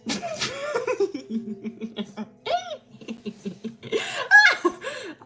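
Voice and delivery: long high-pitched laugh; high giggle fit after a joke. Real, not acted.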